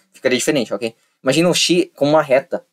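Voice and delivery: ordinary delivery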